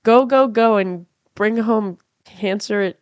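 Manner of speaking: usual speaking voice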